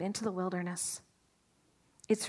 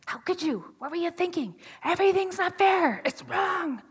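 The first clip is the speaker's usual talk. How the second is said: higher, accusing inner voice; inner critic; 8 semitones higher than usual. Retold or imitated. Preacher acting out the nagging voice in Moses' head, higher and accusing. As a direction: scold yourself from inside your head, pitched up.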